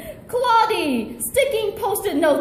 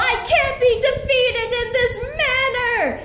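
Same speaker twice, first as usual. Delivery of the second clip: mock scream; 7 semitones higher than usual. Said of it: "Mister Snuggles screamed".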